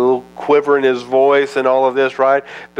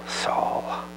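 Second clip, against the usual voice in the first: breathy whispered name, full of grief; grieving whisper; sorrowful whisper; 11 dB quieter than usual. Acted. God grieving over Saul.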